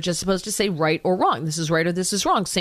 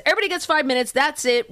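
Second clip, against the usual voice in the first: higher, mock chairman's yell; retold yell; 6 semitones higher, faster than usual. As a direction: bark it out like a fed-up chairman, half-acted. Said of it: "finally yells at everybody and goes".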